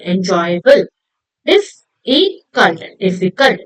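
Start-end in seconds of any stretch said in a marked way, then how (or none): 1.5-2.8 slow 'difficult' as dif, fi, cult; syllable by syllable. Shown as a demonstration. Teacher recapping three syllables for students, syllable by syllable. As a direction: break 'difficult' into three spaced beats. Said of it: "Three syllables".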